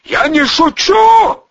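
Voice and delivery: high, loud, drawn-out threat; shouted warning. A narrator's reading. Volyna warns he is serious, shouting. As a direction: warn him at a shout. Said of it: "предупредил Волына".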